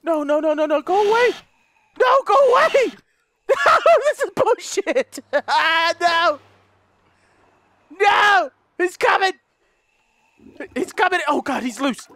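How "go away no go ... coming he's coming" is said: very high, loud shriek breaking into a laugh; panicked shouting. Real, not acted. Gamer caught by the monster, panicked shriek. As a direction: yell him off, then crack up.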